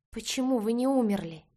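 громкий выкрик выше обычного; выкрикни прямо в лицо мужу; выкрикнуть. A narrator's reading. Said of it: "выкрикнула я в лицо". (громкий выкрик)

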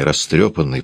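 ordinary delivery

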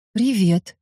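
distant, absent-minded hello; detached. A narrator's reading. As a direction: say it as if miles away. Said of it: "Отрешённо". (отрешённо)

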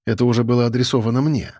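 ordinary delivery, nothing marked

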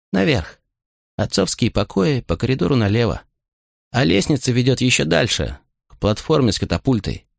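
lightly voiced young guide; a hint of a tense young guide, hushed; young man voice.

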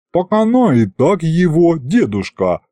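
ordinary delivery

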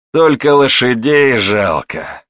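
slow, drawn-out regret; грустное замечание. A narrator's reading. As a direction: voice a touch of pity, slowly. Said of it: "грустно заметил Пётр". (с лёгкой грустью, протяжно)

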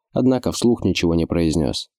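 ordinary delivery

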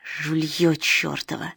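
slow breathless whisper; wheezed whisper. A narrator's reading. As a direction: rasp it out slowly. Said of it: "вор просипел".

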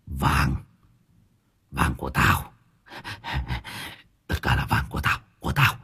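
low, hushed, eerie hiss; lightly voiced crazed man hissing over his gold; hissing possessed voice. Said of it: "rít lên". (rít lên khe khẽ, giọng trầm ma quái)

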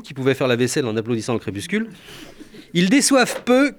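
ordinary delivery

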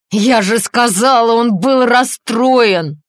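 loud, high, drawn-out yell; crying out. A narrator's reading. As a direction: shout it in exasperation, stretching the words. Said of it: "крикнула Линда". (громкий протяжный крик)

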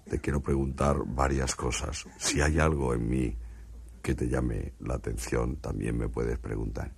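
calm the frightened man as an alien. Acted. Martian speaking to a frightened stutterer in a low alien voice.

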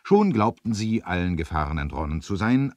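usual speaking voice

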